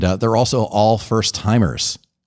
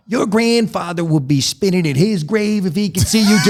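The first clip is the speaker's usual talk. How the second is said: put-on slow, higher-pitched Italian-American uncle's accent; imitated uncle's accent; 7 semitones higher than usual. Uncle scolds teenage nephew over his piercing, Italian-American accent. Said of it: "Oh, I can hear that accent".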